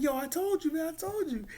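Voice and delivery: high-pitched imitated panic, I-told-you-so; mock panic. [name] says told you.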